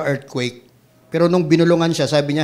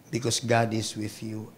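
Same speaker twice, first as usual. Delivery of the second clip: ordinary talk, nothing marked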